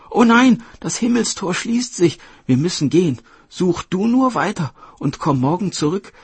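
high, loud, pressing fairy voice; urgent fairy sisters. Acted. Fairies leaving their sister as the gate closes, high urgency. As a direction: exclaim in alarm, then urge her to keep searching.

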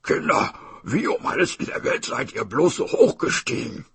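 lightly voiced tiger, calling up; tiger's wondering call; puzzled tiger. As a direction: ask up the tree as a baffled tiger. Narrator voices tiger asking.

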